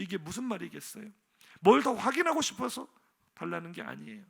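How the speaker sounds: normal talking voice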